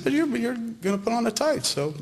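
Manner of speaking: high, whiny mock retort; childish jab at a friend; petulant comeback.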